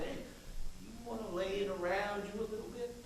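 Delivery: softly voiced sly offer; low whisper, retold. Politician retelling his pitch to the attorney general, low and hushed. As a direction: drop to a hushed, confiding murmur. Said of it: "and I whisper low".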